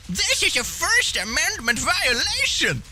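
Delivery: high, slow whiny indignation; exaggerated high-pitched protest; mock outrage. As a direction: squeal it like an offended complainer. Mocks an outraged commenter.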